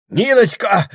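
high, loud cry; desperate calling. A narrator's reading. Прохор calling for Ниночка, loud cry. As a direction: call out loudly for help.

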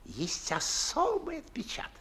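hushed confiding whisper; whispered aside. Acted. Old gentleman confiding to his guest, whispered. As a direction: lean in and whisper it like a secret.

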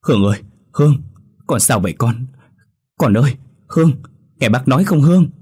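put-on woman urgently calling to a girl in shock; alarmed calling. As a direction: call out to reach her through the panic. Mother calling to terrified girl.

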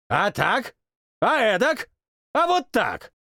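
rising high exclamations; exclaiming. Acted. King plays cards, rising high exclamations. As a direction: slap each card down with a cry. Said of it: "сопровождал восклицанием".

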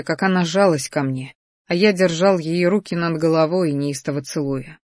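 usual speaking voice

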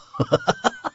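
a high burst of laughter; hearty laugh. Acted. Velchaninov laughing heartily at the drunk youth. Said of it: "از ته دل خندید".

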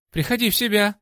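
high, urgent shout; barked order. A narrator's reading. Максвелл rouses the knocked-down fighter, barked order. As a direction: bark it to snap the fighter awake. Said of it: "рявкнул Максвелл".

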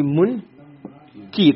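"mun", a pause, then "ki"; syllable-by-syllable pronunciation. Shown as a demonstration. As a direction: split it into "mun" and "ki", with the i vowel on the k. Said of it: "the kasra is on the kaf".

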